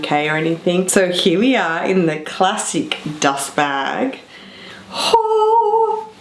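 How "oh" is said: awed oh. Real, not acted. She pulls out the classic handbag, a high amazed oh. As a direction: gasp at the bag.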